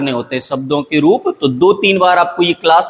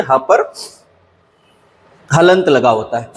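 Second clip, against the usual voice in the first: ordinary talk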